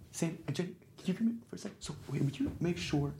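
soft unvoiced whisper to a helper. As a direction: lean aside and whisper the request. [name] quietly calls an assistant.

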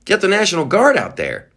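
high, fast, exasperated rant; mock governor rant. Imagined governor demanding troops, fast high rant. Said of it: "these governors would be".

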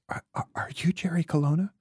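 tender fan. Retold or imitated. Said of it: "very tenderly says".

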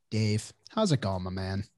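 ordinary delivery

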